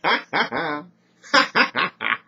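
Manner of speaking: loud, high cackle; acted taunting ha-ha-ha; mocking laugh. Brenna mocks Morgan, laughing.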